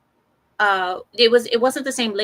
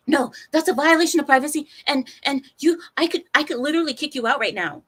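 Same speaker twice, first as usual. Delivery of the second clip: fast mock scolding; 5 semitones higher, 4 dB louder, faster than usual. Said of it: "She's like".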